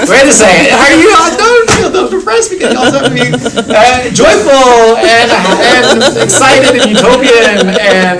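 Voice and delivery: high, rushing, laughing mock alarm; hammed-up pitch jump, then stretched out slow; mock bewildered teasing. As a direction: playfully pretend to scold the class. Teasing students about their feelings.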